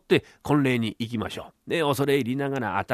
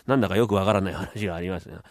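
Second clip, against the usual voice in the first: ordinary delivery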